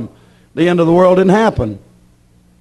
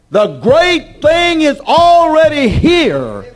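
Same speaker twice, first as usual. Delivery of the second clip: full-force preaching; 10 semitones higher, 4 dB louder than usual. Acted. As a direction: stretch out each key word and proclaim it.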